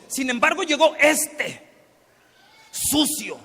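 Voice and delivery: bitter brother sneering at the returned sibling; contemptuous. Acted. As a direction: spit out "sucio" with disgust. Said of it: "No, dice".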